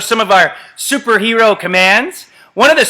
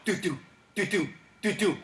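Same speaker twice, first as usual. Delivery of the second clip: much softer, steady doo doo; heartbeat sound; 12 dB quieter than usual. Shown as a demonstration. Coach demonstrating the doctor command: soft mock heartbeat. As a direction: tap out a quiet heartbeat. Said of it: "you're gonna go".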